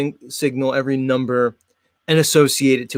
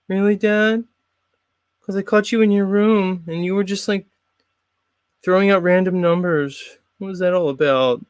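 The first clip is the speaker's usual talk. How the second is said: put-on lightly higher kid voice; a kid calling out dad's secret habit; prying son; 5 semitones higher than usual.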